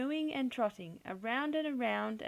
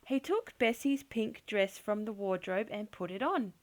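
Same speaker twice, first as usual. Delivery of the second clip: ordinary delivery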